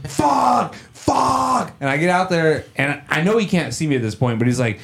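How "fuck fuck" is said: high-pitched yell at no extra volume; retold yelling. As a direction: imitate the neighbor yelling curses. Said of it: "He's yelling".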